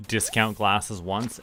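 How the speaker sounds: ordinary delivery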